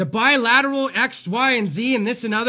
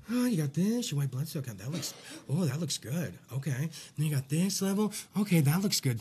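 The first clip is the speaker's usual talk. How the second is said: low, much softer mock mumble; mumbling nurse; 7 semitones lower, 10 dB quieter than usual. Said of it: "mumbling over it".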